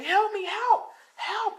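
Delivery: very high, desperate calls for help; crying for help. Acted. Deku fleeing wolves, crying for help very high. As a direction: wail it out while fleeing. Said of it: "he's crying".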